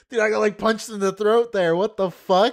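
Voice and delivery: loud, high-pitched disbelief; stunned outburst. Real, not acted. Reactor stunned by the crying streamer, loud shock. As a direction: blurt it out loudly, as if hit out of nowhere.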